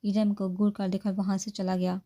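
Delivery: ordinary delivery, nothing marked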